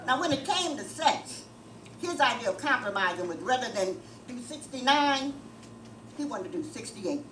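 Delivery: ordinary talk, nothing marked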